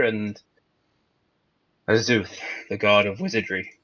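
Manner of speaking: loud call across the room. Real, not acted. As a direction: call out to the group. Calling out to the party.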